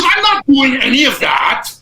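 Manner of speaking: high, loud, drawn-out shout; hammed-up indignant holler; imitating angry teammate. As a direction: yell it in mock outrage. Retold teammate's angry outburst.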